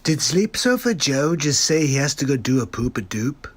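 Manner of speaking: ordinary talk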